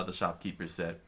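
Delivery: usual speaking voice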